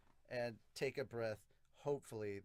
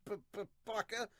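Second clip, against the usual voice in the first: put-on higher, stammering anime girl; flustered princess; 10 semitones higher, 4 dB louder than usual. Princess reacting to Yami holding her, flustered high stammer. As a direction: protest in a raised voice, stumbling on the insult. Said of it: "She reacts by saying".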